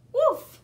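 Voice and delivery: loud barked woof like a puppy; loud playful puppy woofs; dog bark. Acted. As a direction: bark it out like Biscuit. Dog barks in story.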